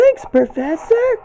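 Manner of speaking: very high, slow cartoon girl voice; Blossom impression. Gamer tries Blossom thanking the Professor, very high. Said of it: "I'm trying to see if I can do an impression of Blossom".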